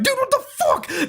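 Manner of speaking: very high, squealed mock outrage; imitated outrage in a falsetto; mock furious dad. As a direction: screech it as a hammed-up angry dad, half laughing. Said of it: "he was furious as fuck".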